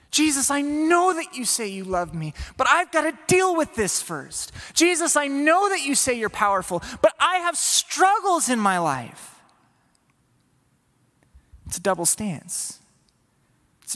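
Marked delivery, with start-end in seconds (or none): 0.1-9.3 higher, pleading excuse; torn, anxious plea; doubting prayer. Acted. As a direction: bargain with Jesus. Voicing doubting believer's prayer.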